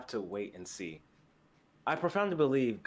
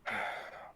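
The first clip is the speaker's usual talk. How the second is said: a long, breathy sigh of defeat; heavy sigh; 5 dB quieter than usual. Acted. JJ, unable to finish his sentence about Moose, sighs heavily. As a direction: let out a weary sigh. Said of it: "He sighs".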